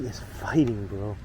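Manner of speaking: ordinary talk, nothing marked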